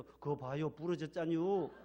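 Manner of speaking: slow, placid Chungcheong "told you so"; Chungcheong slow calm. Retold or imitated. Chungcheong man with broken arm, slowly and calmly saying told you. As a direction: drawl it with no anger in Chungcheong dialect. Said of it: "충청도 분들 팔을 삐틀어도".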